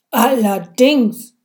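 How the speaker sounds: slow booming shout; donnernd, langsam und laut; thundering. A narrator's reading. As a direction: bellow the single word down at him. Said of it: "donnerte der Beamte".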